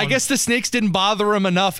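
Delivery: ordinary delivery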